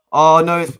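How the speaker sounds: sudden loud high shocked outburst; shocked cry. Real, not acted. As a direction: cry out as the ball goes in. Goal conceded during live match.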